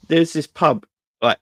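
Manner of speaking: ordinary talk, nothing marked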